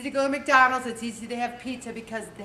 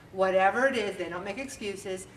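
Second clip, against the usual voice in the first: usual speaking voice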